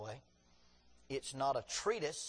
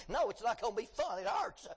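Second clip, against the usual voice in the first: mock protest; 6 semitones higher, 4 dB louder than usual. His own retort to therapist.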